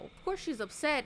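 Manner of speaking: ordinary delivery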